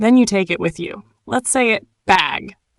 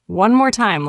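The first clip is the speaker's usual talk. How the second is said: usual speaking voice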